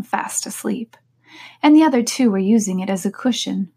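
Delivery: ordinary delivery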